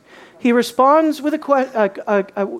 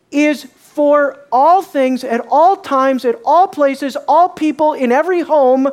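emphatic sermon crescendo; building preacher emphasis; 5 semitones higher, 5 dB louder than usual. Real, not acted. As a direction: hammer each all as it builds. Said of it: "preaching emphasis on all".

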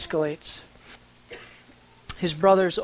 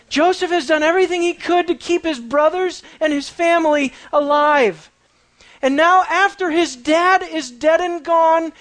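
preaching build; 7 semitones higher than usual. Acted. Preacher rouses the congregation over the brothers' plea, with rising fervour. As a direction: drive the point home with passion.

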